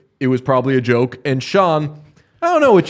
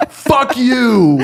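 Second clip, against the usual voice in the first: higher, louder mock insult from a drunk fan; 8 semitones higher, 4 dB louder than usual. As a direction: fling it out like a tipsy fan on Twitter. Drunk fan voiced mockingly.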